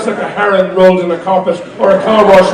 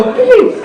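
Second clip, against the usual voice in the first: creature hum. Acted. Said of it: "they go".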